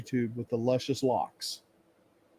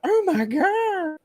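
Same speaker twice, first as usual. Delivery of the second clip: much higher, slow drawn-out squeal; excited squeal; much slower than usual. Retold or imitated. Answering Eris's excitement, drawn-out high squeal. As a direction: stretch it into a delighted shriek. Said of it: "And I went".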